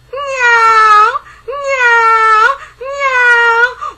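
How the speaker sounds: high, loud yowling meows; shrill, drawn-out cat cries; scary cat meows. Acted. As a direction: yowl like a monster cat. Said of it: "it sounded exactly like this".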